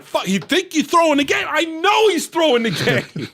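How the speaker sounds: high, shouted retort in imitation; mad as shit. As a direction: throw the suspicion back, outraged. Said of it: "mad as shit".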